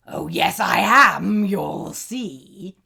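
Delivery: loud, then hushed troll threat; troll threat dropping to a whisper; smug troll. A narrator's reading. Troll insists.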